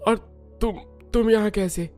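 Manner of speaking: halting words with groans and long pauses; dazed, wounded waking. Acted. Wounded man waking up, confused. (घायल, अटक-अटक कर हैरानी से)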